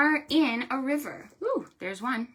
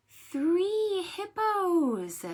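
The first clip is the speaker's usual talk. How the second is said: slow high three; 10 semitones higher, much slower than usual. Shown as a demonstration. Librarian finishing a count with children.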